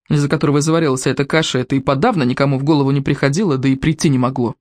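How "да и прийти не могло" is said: ordinary delivery, nothing marked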